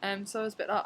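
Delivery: ordinary talk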